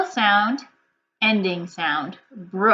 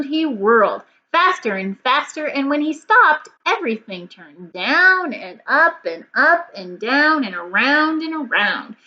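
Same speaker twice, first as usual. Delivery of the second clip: put-on lively, louder storybook reading to children; story read-aloud; 3 semitones higher, 4 dB louder than usual. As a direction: read it with big expression for kids. Reads the story aloud to class.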